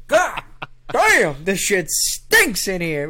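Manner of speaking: loud, high God damn, then slowing down; unashamed dad. Retold or imitated. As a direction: imitate a dad loudly disgusted by a stink. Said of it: "the father was not shy about it".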